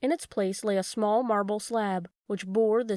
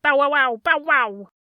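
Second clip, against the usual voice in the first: loud, high barking like a real watchdog; loud mock dog barks; 5 semitones higher, 8 dB louder than usual. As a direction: bark it out like a guard dog. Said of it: "he barked as if he were a real watchdog".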